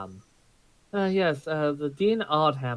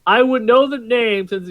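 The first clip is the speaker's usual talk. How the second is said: loud; 8 semitones higher, 8 dB louder than usual. Acted. Annoyed at the dean question.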